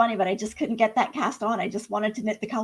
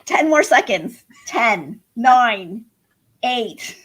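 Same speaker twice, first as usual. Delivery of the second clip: loud countdown; 6 dB louder, slower than usual. Real, not acted. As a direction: call out the countdown.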